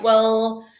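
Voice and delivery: plain reading voice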